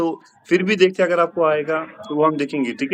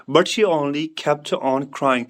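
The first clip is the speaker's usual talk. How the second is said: slow model sentence; much slower than usual. Shown as a demonstration. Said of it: "teacher models the answer".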